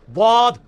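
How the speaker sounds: द्वाद said as a heavy, loud emphatic ḍ; Arabic dad demo. Shown as a demonstration. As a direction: press the tongue side for द्वाद. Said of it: "इसको ऊपर के दांतों की जड़ में लगाना है".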